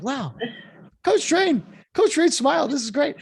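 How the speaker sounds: high, loud, delighted wow about the coach smiling; excited awe. Retold or imitated. Former player recalling coach's rare smile, thrilled and high. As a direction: gush like a thrilled young player. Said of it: "I was just like".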